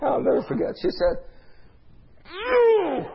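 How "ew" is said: high-pitched shrill outburst of fury; imitated scream. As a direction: imitate a livid woman's screamed "Ew". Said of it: "she began to scream".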